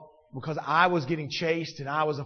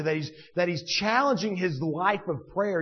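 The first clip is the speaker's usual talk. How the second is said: normal talking voice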